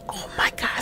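breathless whispered gasp; stunned hush under the breath; shocked whisper. Real, not acted. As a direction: whisper it in disbelief. Shocked at game text.

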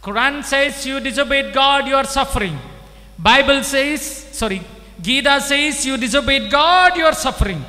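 loud, high preaching at full force; full-force sermon proclamation. Real, not acted. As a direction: preach it out to the hall. Preacher driving home scripture.

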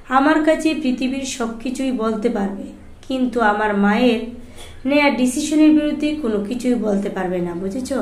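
usual speaking voice

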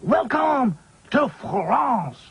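high, very slow and grand; French accent. A narrator's reading. Claude welcomes the dolls to France, slow French accent. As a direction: announce it proudly, French style. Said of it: "said Claude".

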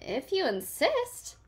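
high, slow and sweet; friendly bug sweetly accepts a fight. A narrator's reading. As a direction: answer sugary sweet, unbothered. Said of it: "answered the friendly ladybug sweetly".

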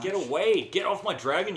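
high, loud warding-off shout; exasperated gamer shout; heated yell. Real, not acted. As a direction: drive them off your prize. Fighting over dragon in game.

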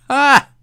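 a short, loud, high shout of surprise; sudden yelp. Real, not acted. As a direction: let out a startled, high, loud cry. Sudden shout mid-commentary.

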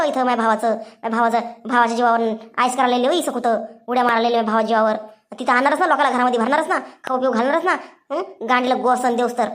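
a low voiced aunt scolding the maid; ranting sister-in-law. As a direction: spit it out low and quick with contempt.

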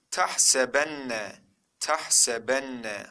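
"Tahsebenne" read slowly, syllable by syllable; model reading. Shown as a demonstration. Teacher reads the next word for students, slow model reading. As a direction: draw out each syllable of "Tahsebenne". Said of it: "Sıradakine bakalım".

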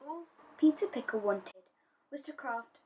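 ordinary delivery

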